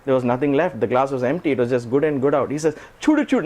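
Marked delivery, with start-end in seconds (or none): 3.0-3.4 put-on much higher, gleeful childlike voice; like a five-year-old. Swami delighting like a small child over the clean glass, high childlike glee. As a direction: squeal it like an excited little kid showing off. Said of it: "Now he's like a five-year-old".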